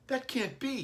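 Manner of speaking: put-on high child's voice, pleading; young son protesting to his dad, high and pleading; little boy. A narrator's reading. Billy voiced by reader.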